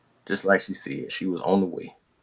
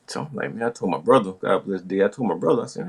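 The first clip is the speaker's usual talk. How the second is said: ordinary talk, nothing marked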